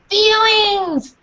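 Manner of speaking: high, eager shout of a class answering together; excited group shout; kids shouting. A narrator's reading. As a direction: call it out like a whole class at once. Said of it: "everyone shouted at once".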